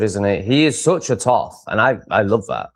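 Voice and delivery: ordinary talk, nothing marked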